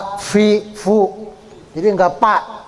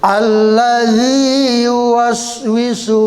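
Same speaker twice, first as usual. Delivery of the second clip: slow drawn-out melodic chant; Quran recitation; much slower than usual. Shown as a demonstration. Teacher reciting the whole surah for students. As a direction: recite the surah slowly with tajwid.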